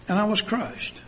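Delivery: ordinary delivery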